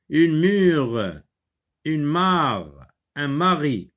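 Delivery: high, projected, falling on each word; reading a word list. Shown as a demonstration. Teacher reads two-syllable exercise words, clearly projected. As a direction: model each word clearly, with pauses between. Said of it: "Je lis des mots".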